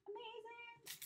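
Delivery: very high, soft singsong; high awed echo. Real, not acted. Admiring the gift to herself, high awed echo. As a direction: coo it softly, way up high.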